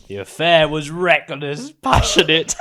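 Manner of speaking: melodramatic narration. Acted. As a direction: ham up the scandal.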